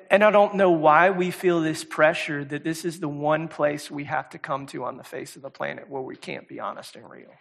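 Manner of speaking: ordinary talk, nothing marked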